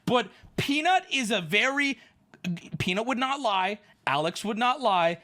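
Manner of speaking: normal talking voice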